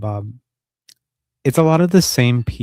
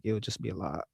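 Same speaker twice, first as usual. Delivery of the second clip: normal talking voice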